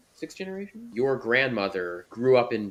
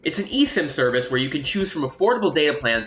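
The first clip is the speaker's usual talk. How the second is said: upbeat, brisk YouTube sponsor pitch; sponsor ad read; 4 dB louder, faster than usual. Acted. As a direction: sell it like a quick ad break. Sponsor ad read mid-interview.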